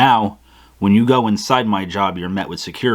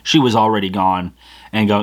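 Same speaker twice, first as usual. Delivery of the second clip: ordinary talk, nothing marked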